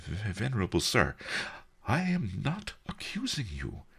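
meek, placating merchant; contrite. A narrator's reading. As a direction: soothe the offended elder apologetically. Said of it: "the merchant said contritely".

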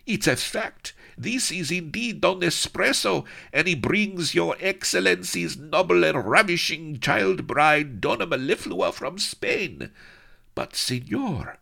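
high excited toady voice; excited yipping. Acted. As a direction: yip the news eagerly. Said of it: "he yipped".

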